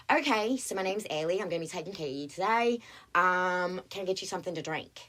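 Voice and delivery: put-on quick bright Australian waitress accent. Waitress greeting her tables, breezy Australian accent. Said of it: "try an Australian accent".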